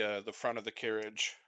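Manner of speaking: normal talking voice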